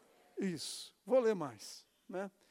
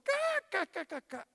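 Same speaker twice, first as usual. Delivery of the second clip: jeering cackle, first burst high; mock mocking laughter; 11 semitones higher, slower than usual. Preacher mimicking mourners scoffing at Jesus, mocking cackle. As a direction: imitate the crowd laughing at Jesus. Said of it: "Todo mundo".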